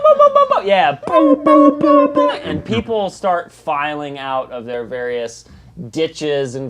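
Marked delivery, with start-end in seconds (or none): 0.0-2.3 bell booms. Acted. GM ringing bell with club.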